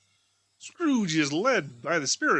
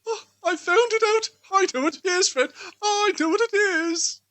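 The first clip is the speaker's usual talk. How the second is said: much higher, louder eureka cry; excited guest; 7 dB louder than usual. Acted. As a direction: burst out with the answer. Guest solves the riddle excitedly.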